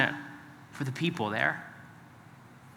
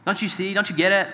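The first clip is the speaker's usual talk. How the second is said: lightly acted exasperated rebuke, a touch higher; mock scolding; 3 semitones higher than usual. Preacher voicing a harsh rebuke to idol worshippers, mildly exasperated. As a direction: scold them half-heartedly, shaking your head. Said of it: "shaking his head at them saying".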